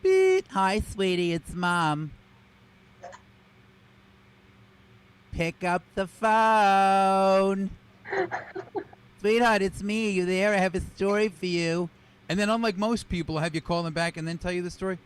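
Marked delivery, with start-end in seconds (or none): 0.0-0.5 high sharp imitated beep; loud high electronic beep; answering machine beep. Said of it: "starts off like this". 0.5-11.9 lightly voiced mother leaving a message; a hint of a chatty mom calling her son; mom on voicemail. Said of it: "every one of my mother's messages starts off like this".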